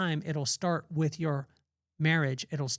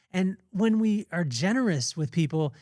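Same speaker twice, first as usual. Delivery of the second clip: normal talking voice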